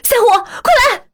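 high, loud yell for help; desperate shout calling the dog. Retold or imitated. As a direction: scream the dog's name to come save you. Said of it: "我就大喊".

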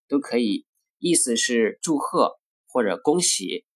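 usual speaking voice

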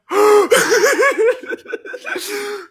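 laughing till almost crying; helpless high laugh; high tearful laughter. Real, not acted. Retelling the kowtow story, laughing helplessly.

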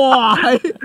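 high laughing reply. Real, not acted. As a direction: laugh while agreeing. Laughs at lap joke.

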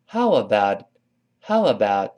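"How about" run together quickly as one word; short, joined-up "How about"; linked How about. Shown as a demonstration. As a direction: link "How" into "about" in one smooth sweep. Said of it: "这么一个".

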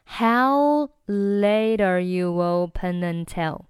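"How late are you open until" slowly drawn out; slow-motion model of connected speech; slow linked demo. Shown as a demonstration. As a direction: stretch each syllable, keeping the words linked. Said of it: "整句话就变成了".

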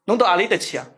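a light Shanghainese uncle voice, asking where to; mock old Shanghai taxi driver; Shanghai uncle accent. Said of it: "他就把着方向盘看着我说". (模仿上海爷叔口音)

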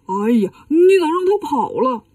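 exclaimed grumble in a put-on voice; reproachful complaint. Bajie blames Wukong as the monster flees, reproachful complaint. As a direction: blame him with a dismayed cry. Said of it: "就埋怨悟空".

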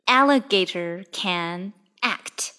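Alligator can act said slowly, every word separate; slow and clear. Shown as a demonstration. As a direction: read it slowly for learners to repeat. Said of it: "大家可以跟我一起来读一下这句话".

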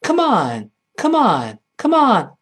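repeated, drawn-out hurry-up call; urging someone on. Acted. Teacher acting an American hurrying someone, urging call. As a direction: egg them on, each one falling. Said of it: "催催对方".

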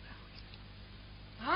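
slow unvoiced whisper. Sister-in-law whispers the secret to him, slow whisper. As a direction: breathe it secretly into his ear. Secret told in his ear.

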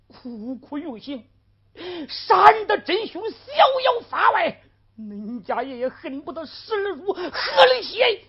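由低沉渐转为带哭腔的高声怒吼; 越骂越恨，最后嘶喊发誓; 咬牙切齿的怒骂. Acted. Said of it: "咬牙切齿骂道". (徐文彪向狗官痛骂鸣冤，由压抑到怒吼)

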